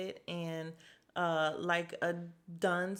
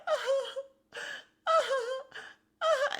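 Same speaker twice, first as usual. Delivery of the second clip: very high fake sobbing uh-huhs, growing louder; mock falsetto sobs; 4 dB louder, slower than usual. Rinna crying without a tear, hammed-up falsetto sobbing. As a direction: ham up tearless whimpers.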